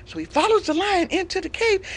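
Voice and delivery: ordinary delivery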